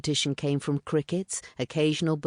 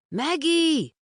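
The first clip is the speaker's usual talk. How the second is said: higher, louder call across the yard; 13 semitones higher, 5 dB louder than usual. A narrator's reading. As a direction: shout her name to the house. Calling into the dark.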